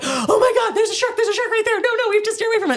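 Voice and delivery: panicked shark alarm. Retold or imitated. Young girl on a boat spotting a fin, high frantic panic. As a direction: cry out like you see a fin. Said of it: "I was like".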